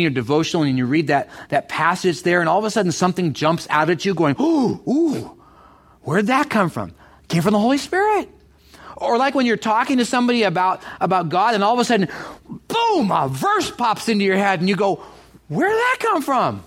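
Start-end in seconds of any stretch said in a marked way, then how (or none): 4.4-6.9 ooh pitched higher, amazed; sudden surprise. Acted. Acting sudden insight. 15.5-16.6 much higher, wondering; amazed question. Acted. Acting a verse popping up.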